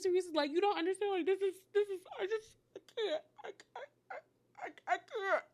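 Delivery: high, thin mock sobbing, breaking up; imitated crying. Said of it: "she just starts crying again".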